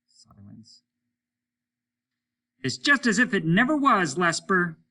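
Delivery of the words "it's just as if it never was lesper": high, loud taunting; mean. A narrator's reading. Hollis taunts Lesper over the radio, mean and loud. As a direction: needle him cruelly. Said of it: "He was being mean".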